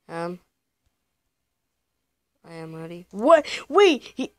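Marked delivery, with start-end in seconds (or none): 3.1-4.3 shouted high, much louder disbelief; shocked. Real, not acted. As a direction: blurt it out in sudden shock. Shocking twist in game.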